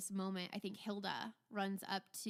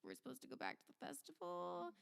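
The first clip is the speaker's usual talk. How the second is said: small, high, hushed question; soft, raised, incredulous murmur; bewildered crowd; 5 semitones higher, 9 dB quieter, faster than usual. Acted. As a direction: voice the puzzled onlookers very softly. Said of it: "staring at him like".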